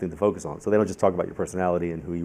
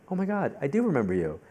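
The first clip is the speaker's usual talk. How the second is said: high-pitched dawning realization; sudden recognition; 7 semitones higher than usual. Retold or imitated. Reader recognizing the lady from Phoenix, sudden recognition. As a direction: lift the pitch as the memory lands. Retold moment of suddenly remembering.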